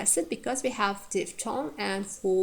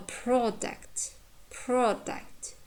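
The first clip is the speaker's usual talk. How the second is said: "product" said PROD-uct; strong PROD, weak ending in "product"; careful repeated "product", stressing the first syllable; 3 semitones higher, 3 dB quieter, much slower than usual. Shown as a demonstration. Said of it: "first syllable stressed in the words like".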